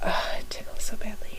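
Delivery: soft whisper. Real, not acted. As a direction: whisper it close. Whisper as sneeze builds.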